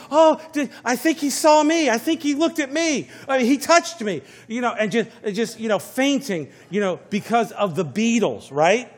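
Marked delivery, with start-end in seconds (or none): 0.1-4.2 high, loud, breathless gushing fan; swooning fangirl. Retold or imitated. Preacher imitating a swooning teen fan. Preacher mimics fainting Beatles fans.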